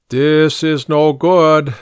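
slow, emphatic grumble, not hushed; muttered displeasure. A narrator's reading. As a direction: grumble it slowly and heavily. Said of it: "the original muttered".

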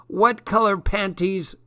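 high-pitched Scottish caller; Scottish accent. A narrator's reading. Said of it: "bloke with a Scottish accent".

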